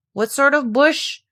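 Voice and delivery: ordinary delivery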